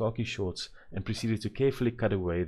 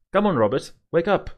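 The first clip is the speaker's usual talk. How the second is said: high, loud call to wake up; 10 semitones higher, 9 dB louder than usual. A narrator's reading. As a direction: shout him awake cheerfully. Mother waking sleepy son.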